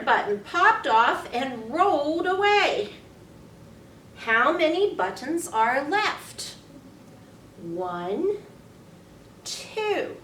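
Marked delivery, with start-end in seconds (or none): none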